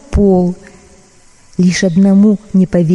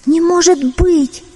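higher, slow astonished kitten; disbelieving kitten; 8 semitones higher, slower than usual. Acted. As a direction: gasp it out in disbelief. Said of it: "не поверил котёнок".

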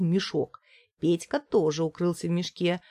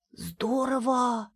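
put-on slow, thrilled higher kid voice; delighted; 5 semitones higher, much slower than usual. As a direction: savor the fun. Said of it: "сказал Петька".

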